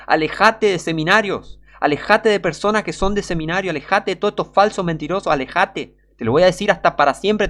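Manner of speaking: high, insistent preacher's exhortation; vehement warning. Real, not acted. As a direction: hammer the warning home, pitched high. Urgent warning to stay away. (advertencia vehemente, con voz aguda)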